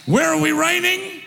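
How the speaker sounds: rousing sermon delivery, calls ringing out; fired-up preacher calling his church to rise; full-force preaching. Acted. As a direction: drive it home to the congregation. Preacher exhorting congregation.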